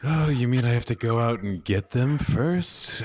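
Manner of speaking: ordinary talk, nothing marked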